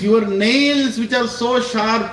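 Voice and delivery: slow, drawn-out awe; lightly voiced devotee in awe; awed devotee. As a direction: dwell on each fierce image. Prahlad describing fierce Narsingadev.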